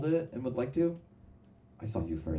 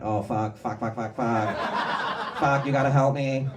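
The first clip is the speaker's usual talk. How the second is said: panicked car; 4 dB louder than usual. Retold or imitated. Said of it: "the Waymo went".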